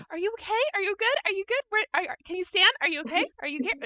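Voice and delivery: high, quick, anxious questions; worried checking. Acted. Kira checks the fallen friend for bruises, high and anxious. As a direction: fuss over him in a panic. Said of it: "She's like".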